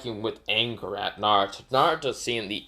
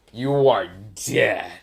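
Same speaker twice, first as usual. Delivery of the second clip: lightly voiced menacing villain; menacing threat; slower than usual. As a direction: promise death slowly and coldly. Naruto closing in to kill.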